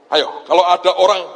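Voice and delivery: ordinary delivery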